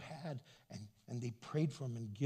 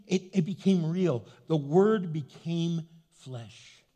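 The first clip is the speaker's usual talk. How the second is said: loud, driving preacher cadence; fervent preaching; 6 semitones higher, 8 dB louder than usual. Acted. Pastor urging the church to believe, loud and fervent. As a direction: pound out the good news to the congregation.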